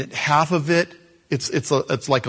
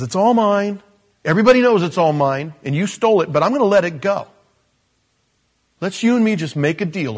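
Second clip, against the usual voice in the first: loud, fast pushy Laban; a greedy uncle laying claim; loud demanding voice, brushing it off; 5 semitones higher, 7 dB louder, faster than usual. Retold or imitated. Laban's greedy speech retold.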